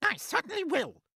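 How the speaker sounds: high, loud, angry snap; snapped. Acted. Aunt agreeing to punish the boy, snapping. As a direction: snap back in a high, fierce voice. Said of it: "Aunt Spiker snapped".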